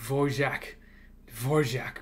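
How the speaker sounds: soft "Dvorak" said as Vorzhak; quiet Czech-style Dvořák; Dvořák the music-school way. Shown as a demonstration. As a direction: say "Dvorak" with a zh in the middle. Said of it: "they would all call it this way".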